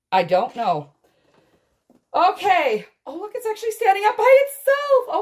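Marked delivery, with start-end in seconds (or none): 3.1-5.2 high, loud and quick with delight; quick, bright delighted chatter. Real, not acted. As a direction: rush it out, thrilled. Delighted at a standing toy.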